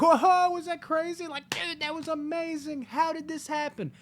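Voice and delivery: high-pitched, bursting with wonder; mock amazed excitement. Partygoers to friends after a possession game, hammed-up excitement. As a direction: gush like a thrilled partygoer.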